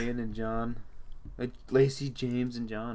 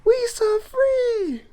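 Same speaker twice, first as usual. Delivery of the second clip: crying movie line; 6 dB louder, much slower than usual. Pretend. Acting out final movie line.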